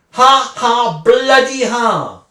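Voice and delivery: spoken fake laugh, bitter and slow at the end; bitter sarcastic "ha, ha"; scornful fake laughter; sarcastic mock laugh.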